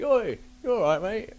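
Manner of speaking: calls over high and bright to a stranger; matey nightclub greeting, a lively hello; cheery greeting. Retold or imitated. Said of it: "And I'm like".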